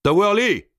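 high, sharp summons; calling out. A narrator's reading. Gournay calls the giant from the next room, calling out. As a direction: call the servant in from next door. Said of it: "appela-t-il".

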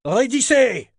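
high, loud command; shouted order. A narrator's reading. Foreman to the crew hauling the ship, shouted order. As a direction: shout to make them haul tight.

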